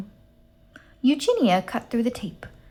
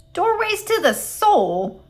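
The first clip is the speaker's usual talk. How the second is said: "Doorways to the soul?" flung up much higher, slow and incredulous; sputtered in disbelief; 12 semitones higher, 5 dB louder, slower than usual. A narrator's reading. Eugenia throwing Gladys's words back at her, sputtering. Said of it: "sputtered Eugenia".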